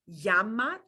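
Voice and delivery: llama with an English Y; Y sound. Shown as a demonstration. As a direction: say llama as "yah-ma". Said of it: "just like a Y".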